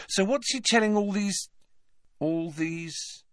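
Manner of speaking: high start, fading off; tailing away. A narrator's reading. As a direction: voice fading mid-question. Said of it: "Harry's voice tailed away".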